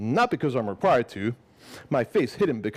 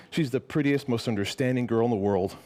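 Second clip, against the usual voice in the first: plain reading voice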